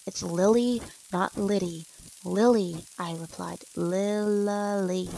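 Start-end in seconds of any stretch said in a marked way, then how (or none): 0.1-2.9 "Lily" hit louder, against "Liddy"; firm, louder stress on "Lily"; emphatic correction. A narrator's reading. Correcting her mispronounced name. 3.8-5.1 "Lily" drawn out syllable by syllable; long, spread-out "Lily"; stretched out. A narrator's reading. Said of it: "he stretched out".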